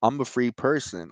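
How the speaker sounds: ordinary delivery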